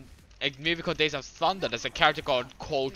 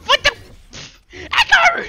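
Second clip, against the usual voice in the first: startled yelp; 14 dB louder than usual. Real, not acted. Gamer startled by an enemy Echo, shrieking. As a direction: yelp the name in real shock.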